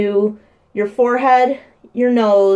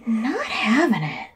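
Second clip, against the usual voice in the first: whisper; 2 semitones higher, 4 dB quieter, slower than usual. Real, not acted. Mom whispering over her fussy baby, whispered. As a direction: drop to a whisper, half to herself.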